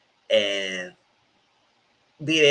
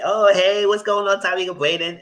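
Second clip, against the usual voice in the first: high, louder sing-song hello; chirpy Kiki greeting; 10 semitones higher, 6 dB louder than usual. Acted. Kiki greeting Tyreek and Brandon on campus, high chirpy hello. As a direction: play her as a bubbly girl calling out hi.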